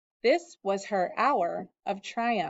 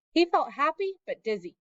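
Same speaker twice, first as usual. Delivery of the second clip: ordinary delivery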